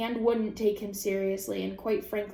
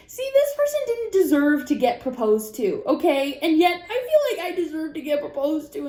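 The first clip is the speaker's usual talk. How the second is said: higher, louder petulant whine; mock-jealous complaint about proposals; whiny self-pity; 7 semitones higher, 6 dB louder than usual. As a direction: sulk out loud like a jealous kid. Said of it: "I'm like".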